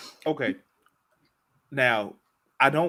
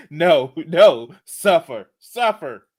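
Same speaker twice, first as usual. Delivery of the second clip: mock sinister; 3 semitones higher, 7 dB louder, slower than usual. Playful command to suffer.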